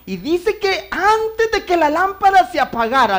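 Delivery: loud, high preaching cry. Acted. Preacher calling out to the congregation, loud. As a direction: preach it out to the church.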